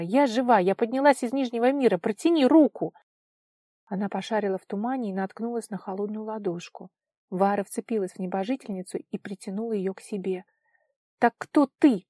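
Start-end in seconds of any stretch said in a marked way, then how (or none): 0.0-2.9 higher, louder, emphatic insistence; insistent. A narrator's reading. Vara assures the girl she is alive, insistent. 11.2-12.1 loud, higher, stern question. A narrator's reading. Vara questions the girl in the fog, sternly. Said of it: "строго спросила она".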